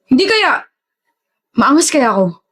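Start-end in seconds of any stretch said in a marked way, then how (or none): 0.0-0.6 sharp gasp, then a high startled question; flustered gasp of surprise. Acted. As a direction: caught off guard, gasp and ask. Flustered at being called sweet.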